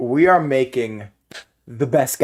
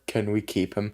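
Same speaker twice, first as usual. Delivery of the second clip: soft pleading Grug; 4 semitones lower, 9 dB quieter than usual. Acted. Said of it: "Grug just turns over".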